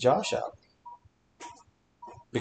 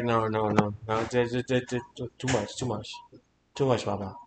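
soft, low Puerto Rican imitation; mock Puerto Rican accent; 3 semitones lower, 6 dB quieter than usual. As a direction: stammer softly in a put-on Puerto Rican accent. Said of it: "Puerto Ricans were just like".

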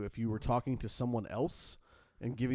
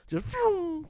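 high whoosh. Retold or imitated. Sound effect of sliding.

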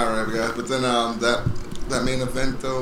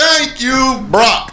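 higher, louder, hyped thank you; excited mock thanks; 9 semitones higher, 3 dB louder, much slower than usual. Hosts thanking Brock in mock glee. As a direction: gush it.

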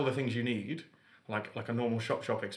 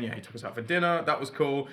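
ordinary delivery

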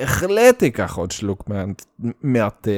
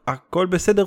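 ordinary talk

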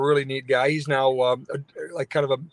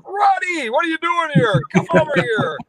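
much higher, louder mock Trump hail; hammed-up presidential bellow across the lobby; imitating the president; 13 semitones higher, 5 dB louder than usual. President spotting Ruddy and calling him over, hammed-up high loud hail.

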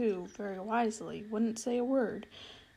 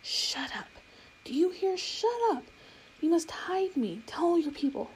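urgent, furious hushed order; high, strained whisper-like urgency; fierce whisper; 6 semitones higher than usual. A narrator's reading. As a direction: hush a friend fiercely. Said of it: "whispered fiercely, furiously in her ear".

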